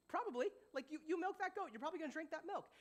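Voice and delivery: high-pitched mock disbelief; comically obvious. Preacher on a goat farmer drinking milk, comically obvious. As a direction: squeak it up as if it needs no saying.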